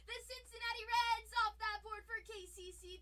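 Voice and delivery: big shouted call of the result; excited loud call-out; loud announcement. Real, not acted. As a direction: belt it out like a hype announcer. Announcing a break result loudly.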